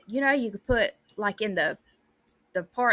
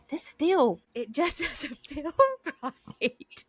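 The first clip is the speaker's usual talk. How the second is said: laughing dad; 2 semitones higher, much slower than usual. Retold or imitated. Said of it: "he was like".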